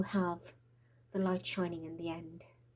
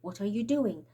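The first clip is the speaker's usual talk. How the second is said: ordinary talk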